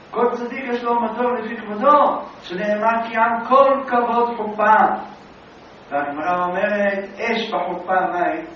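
loud, fervent sermon voice; rising sermon cadence; preaching. Acted. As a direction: preach it with full force. Preaching climax.

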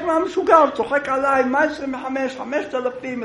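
high, loud indignant yell; outraged shout. Acted. Plaintiff yelling at the rabbi's twenty-five ruble offer. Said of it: "ההוא צועק".

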